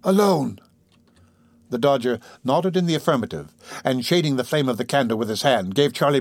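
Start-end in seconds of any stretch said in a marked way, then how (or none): 0.0-0.6 high, drawn-out startled question; startled cry. A narrator's reading. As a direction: echo it in disbelief, pitch raised.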